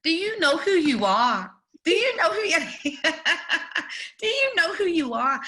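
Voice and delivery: excited, high and loud; starstruck. Retold or imitated. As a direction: gush at him, half laughing. Starstruck repeated question.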